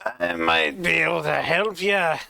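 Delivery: thoughtful Scottish dwarf voice, a touch gruff; musing Scottish accent. Acted. Castle dwarf considering the travelers, musing Scottish voice. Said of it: "he mused".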